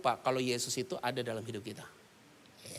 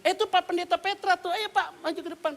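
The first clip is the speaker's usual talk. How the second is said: high, eager fawning call; much higher, ingratiating pastor voice. Retold or imitated. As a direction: sweet-talk the guest forward. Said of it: "Gembalanya berdiri".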